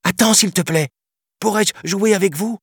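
put-on very high little-boy voice; eager child asking. A narrator's reading. Little boy asking the young elephant to play, eager. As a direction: pipe up with a pleading question. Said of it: "interrogea le petit homme".

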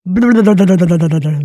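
loud, long burbling camel noise; camels' chorus. A narrator's reading. Camels confirm in chorus, loud drawn-out burble. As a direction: blubber it slowly and loudly like a camel. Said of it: "confirment les chameaux en chœur".

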